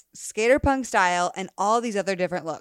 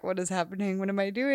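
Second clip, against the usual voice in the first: imitating her own nervous self, softer and hushed; mock-flustered, quietly panicking; 6 dB quieter, faster than usual. Host recalls being nervous on first episode, softly flustered. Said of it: "like, when I was nervous".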